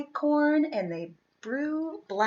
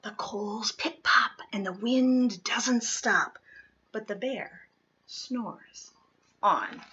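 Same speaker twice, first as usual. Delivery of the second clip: soft, breathy bedtime whisper; hushed storybook whisper; 7 dB quieter than usual. A narrator's reading. Narrator whispering to sleepy children at bedtime. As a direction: whisper it so the little ones drift off.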